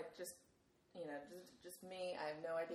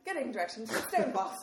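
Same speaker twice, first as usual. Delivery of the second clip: louder mock English accent, laughing; 3 semitones higher, 6 dB louder than usual. Assistant finally obeying, in a laughing mock English accent. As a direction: imitate a British assistant through laughter. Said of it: "with this English accent saying".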